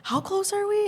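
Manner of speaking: high, rising giddy question; reenacted starstruck jitters; shaking with excitement. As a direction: ask it giddily, pitch climbing.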